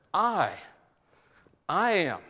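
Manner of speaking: emphatic quote, hit "I" and let it hang before "I am". Retold or imitated. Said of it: "the emphasis here, since it's emphatic".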